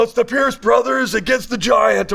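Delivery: mock wrestling announcer shout. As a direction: hype it like a WWE intro.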